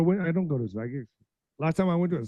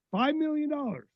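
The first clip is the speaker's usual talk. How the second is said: incredulous repeat; 10 semitones higher than usual. Real, not acted. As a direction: pitch jumps high in disbelief. Repeats figure in disbelief.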